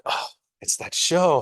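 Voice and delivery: high, slow dawning surprise; sudden realization. Retold or imitated. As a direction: let it dawn on you, slowly. Realizing the British show's influence.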